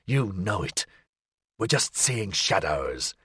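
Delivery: low, slow hissing voice; hissed. A narrator's reading. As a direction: hiss it under the breath. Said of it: "silencing him with a hiss".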